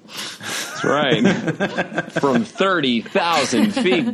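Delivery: laughing through the words; chuckling while agreeing. Real, not acted. Laughing at the coin-dropping joke.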